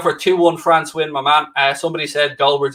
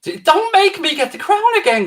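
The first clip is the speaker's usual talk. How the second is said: mock royal threat.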